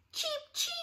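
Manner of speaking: very high, soft chick cheep; chick peeps. Shown as a demonstration. Teacher peeping like a chick for the kids. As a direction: peep it like a tiny chick. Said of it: "say hello to the chick".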